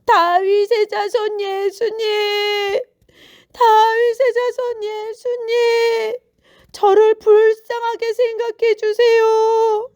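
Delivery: high, loud, drawn-out call; long pleading shouts to someone far away; crying out. A narrator's reading. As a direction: call slowly across a crowd for mercy. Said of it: "소리치기 시작했어요".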